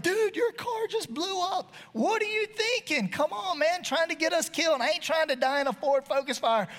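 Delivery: high, exasperated scolding; a high-pitched upset rant at the big boy. Retold or imitated. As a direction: tell him off in disbelief. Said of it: "I'm a little upset at this point".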